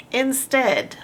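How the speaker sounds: usual speaking voice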